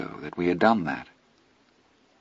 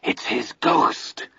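slow, breathless ghost-story whisper; 4 semitones higher, much slower than usual. A narrator's reading. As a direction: whisper it slowly, spooked. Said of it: "Teddy whispered again".

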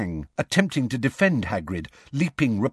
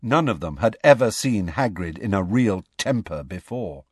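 plain reading voice

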